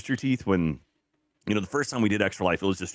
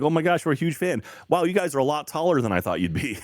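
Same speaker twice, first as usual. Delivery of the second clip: higher-pitched imitation of a fan; lightly voiced fan in awe; amazed fan; 7 semitones higher than usual. Fans coming up to meet their idols, lightly imitated amazement. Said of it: "people coming up to us".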